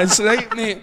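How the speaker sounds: ordinary delivery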